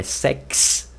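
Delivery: "sex" drawn out with a clear K-S ending; slow "sex", ending in a hissed K-S; K sound in X. Shown as a demonstration. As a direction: slowly show the KS in "sex". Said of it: "se pronuncia com K ali".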